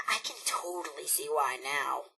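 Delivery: hushed aside, almost unvoiced; conspiratorial whispered aside; sly whisper. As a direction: whisper it like a naughty secret. Robot confides about Rosalind's fun parts.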